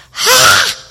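a short shocked "Ha!" as if freaking out; freaked-out yelp. Retold or imitated. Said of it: "you know, freaked out".

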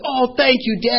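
put-on high, eager child's thanks; little boy thanking his dad, high and excited. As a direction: squeal it like a delighted kid.

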